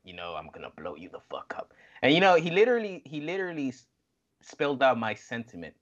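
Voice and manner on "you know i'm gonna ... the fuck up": hushed, voiceless threat like a wrestling promo; mock menacing whisper. Quoting Moxley, whispering the threat to blow you up. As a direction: breathe the threat out, no voice.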